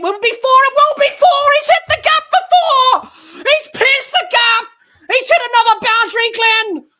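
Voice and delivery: urgent question bursting into high, loud shouts; excited boundary call. Real, not acted. Commentator follows a pulled shot to the rope, excited shout. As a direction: build from suspense to a full-voiced yell.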